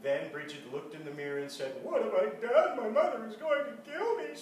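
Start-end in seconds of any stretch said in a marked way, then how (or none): 1.8-4.3 loud, high, laughing panic; panicked child. A narrator's reading. Bridget panicking at her colored face in the mirror. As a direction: cry out in horror at the mirror.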